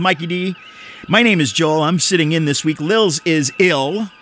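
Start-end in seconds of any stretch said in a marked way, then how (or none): none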